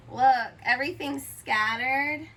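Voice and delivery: ordinary delivery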